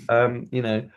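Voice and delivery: usual speaking voice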